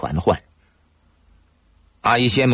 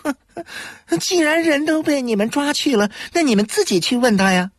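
defiant scoff. A narrator's reading. Dealer evades questioning.

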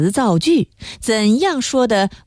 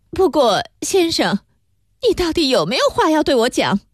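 higher-pitched, impatient demand; 7 semitones higher, faster than usual. A narrator's reading. Emma asking the pharmacist what he has to say, impatiently. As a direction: press for an answer, patience running out. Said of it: "艾玛实在不耐烦了".

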